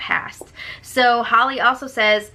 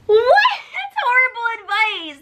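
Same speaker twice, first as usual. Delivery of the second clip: disbelief; 12 semitones higher than usual. Real, not acted. Hearing Christy's advice, real shocked yelp. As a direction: yelp it in outrage.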